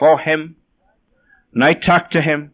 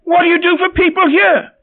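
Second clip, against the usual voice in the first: insistent repeated question; 11 semitones higher than usual. Retold or imitated. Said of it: "he says".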